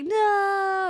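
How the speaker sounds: drawn-out high "no"; mock-anguished wail, stretched out; anguished cry. Acting son's cry in retold scene.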